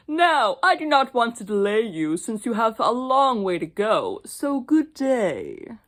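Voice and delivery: loud wolf voice, slowing at the end; sly wolf. Acted. Wolf bidding the girl goodbye, slow and sly. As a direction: draw out the farewell slyly.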